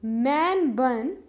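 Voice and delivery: मैन बन drawn out slowly, each syllable clear; slow model word. Shown as a demonstration. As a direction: say मैन बन slowly for the class to copy. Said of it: "English में बोलते हैं". (मैन बन को धीरे-धीरे साफ़ बोलो)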